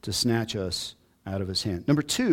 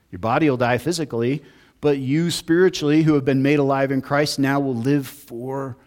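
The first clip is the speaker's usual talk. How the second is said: impassioned preaching; 3 semitones higher, 5 dB louder than usual. Acted. Pastor proclaiming eternal life to the church, louder. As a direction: proclaim it to the congregation.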